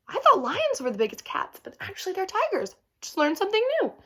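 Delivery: high, delighted surprise; surprised. Real, not acted. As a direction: pitch up like you just found out. Reader surprised by a fact.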